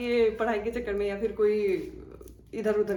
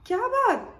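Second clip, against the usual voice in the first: shocked question; 12 semitones higher, 4 dB louder than usual. Retold or imitated. Shocked reply to friend's news.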